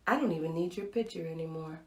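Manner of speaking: low, soft inward thought; hushed realization. Retold or imitated. In that moment she thought, hushed realization. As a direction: say it quietly, as if to yourself.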